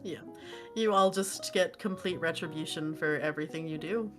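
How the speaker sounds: usual speaking voice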